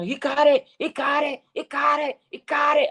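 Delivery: high-pitched excited yell, imitating a touchdown call; reenacted top-of-the-lungs cheer; screaming celebration. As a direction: cry it out over and over like a thrilled announcer. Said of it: "screaming off the top of my lungs".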